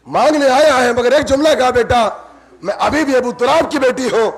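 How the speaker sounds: loud, high preaching; impassioned preaching. Acted. Preacher voices Zainab to her nephew, loud and high. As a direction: build the lament to a peak.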